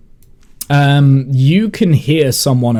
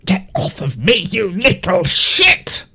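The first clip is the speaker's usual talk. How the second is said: angry insult; 4 semitones higher than usual. Acted. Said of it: "it's just like".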